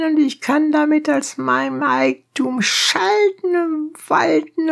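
very high, indignant donkey driver; high-pitched, heated demand; crying opponent. A narrator's reading. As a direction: insist on your rights in a high cry. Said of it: "schrie sein Gegenpart".

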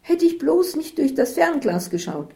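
high-pitched, agitated lament; upset wailing. Acted. Isabel regrets using the binoculars, high upset wail.

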